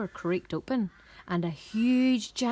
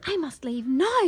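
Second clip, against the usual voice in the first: anxious resolve; 5 semitones higher, 5 dB louder than usual. A narrator's reading. Jack frightened, deciding to flee.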